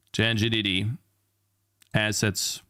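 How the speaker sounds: usual speaking voice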